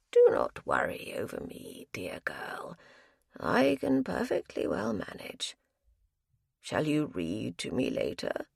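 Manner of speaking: put-on a low, hushed old man's voice; an ailing husband murmuring to his wife; frail old man. Ailing husband reassures his wife, low frail old voice. Frail elderly Ralph speaks.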